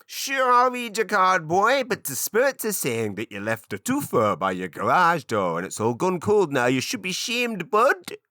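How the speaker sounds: very high, loud, wildly overdone East Coast Canadian witch; screechy-high kitchen witch in a mangled East Coast Canadian accent. Shown as a demonstration. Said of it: "I'm trying to do it stereotypically".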